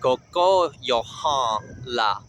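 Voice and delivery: koko yohan la, slow, second KO punched; second-syllable stress. Shown as a demonstration. Teacher demonstrating words for rooster, koko stressed on second syllable. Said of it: "have an emphasis on the second syllable".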